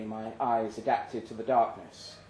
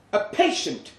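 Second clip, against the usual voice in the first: much higher and louder, startled; surprised exclamation; 10 semitones higher, 9 dB louder than usual. Acted. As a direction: blurt it out in disbelief. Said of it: "I exclaimed".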